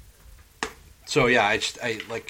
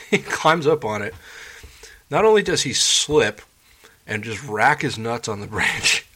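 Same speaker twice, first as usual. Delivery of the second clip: laughing while telling; 4 semitones higher, 7 dB louder than usual. Real, not acted. Laughing through a funny retelling.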